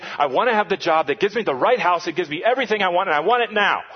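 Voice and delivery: loud, rising, demanding rant; impatient complaint. Acted. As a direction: whine and demand like a spoiled child. Acted prayer of impatient complaint.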